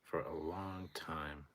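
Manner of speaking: slow, whispered, a touch choked; emotional whisper. Real, not acted. Streamer emotional over the size comparison chart, whispering. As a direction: let it fade out emotionally. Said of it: "I'm a little bit emotional".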